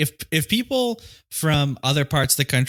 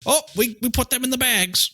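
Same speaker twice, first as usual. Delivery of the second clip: high silly cop voice; squeaky mock officer; 10 semitones higher than usual. Mocking cops in the movie.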